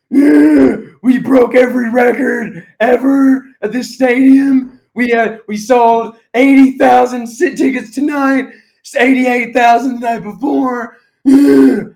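high, loud, slow, hammed-up Triple H shout; Triple H impression. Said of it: "as Triple H does".

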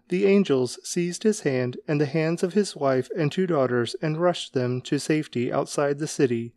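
plain reading voice

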